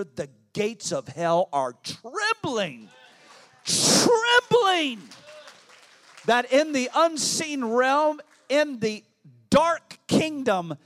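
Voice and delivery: building from strong to roaring shout, pitch soaring; a preacher shaking the gates of hell; preaching at full force. Real, not acted. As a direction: roar it out at the climax.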